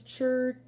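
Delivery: "chur" as a crisp tʃər sound; ending sound chur. Shown as a demonstration. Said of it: "these are our endings".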